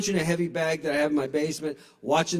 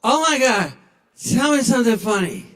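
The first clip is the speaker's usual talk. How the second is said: higher, louder gushing; drunk excitement; 7 semitones higher, 7 dB louder than usual. Retold or imitated. Drunk passenger begging the Uber driver for a joke. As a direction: squeal the request. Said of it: "they're like".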